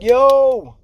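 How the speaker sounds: high, loud drawn-out yell. Retold or imitated. Worker yells at the passed-out crane operator, yelling. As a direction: holler to get his attention. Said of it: "I'm yelling at him".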